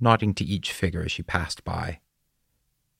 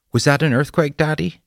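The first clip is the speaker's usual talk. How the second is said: put-on high, loud child's question; tearful child; 9 semitones higher, 6 dB louder than usual. A narrator's reading. Frightened girl asks her father about the shaking, tearful. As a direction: voice a worried little girl, pitched up. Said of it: "Dora's eyes were filled with tears".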